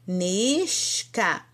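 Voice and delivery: "nishka" slow, with a long held "nish", then "ka"; drawn-out syllables. Shown as a demonstration. Teacher pointing out the form to students, drawn-out syllables. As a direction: stretch "nishka" into two separate syllables. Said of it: "Por aqui vocês vão ver".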